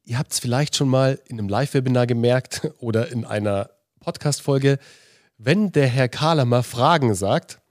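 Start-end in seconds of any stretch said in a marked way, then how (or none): none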